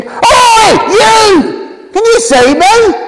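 loud call to get attention; retold shout. Trapped under his truck, shouting to a passer-by, loud desperate yell. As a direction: yell out to a bloke down the road. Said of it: "I shouted at him".

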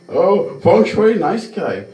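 mock third-person self-praise, pitched high; high-pitched mimicry of an absent acquaintance; Feng Shui. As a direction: put on a high caricature voice, talking about yourself. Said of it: "speaks to themselves in a third per".

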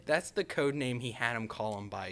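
usual speaking voice

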